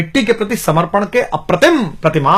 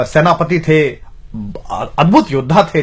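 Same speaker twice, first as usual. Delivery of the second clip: ordinary delivery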